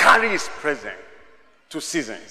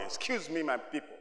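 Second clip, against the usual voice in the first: much softer, murmured asides; 14 dB quieter, faster than usual. Real, not acted. Preacher quietly asking the congregation if they are happy. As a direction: drop almost to a murmur.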